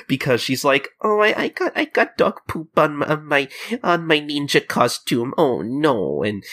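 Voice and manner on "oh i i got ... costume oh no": high whiny mock sob, easing off; put-on tearful wail; mock crying. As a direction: imitate a kid crying, starting high. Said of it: "she starts crying".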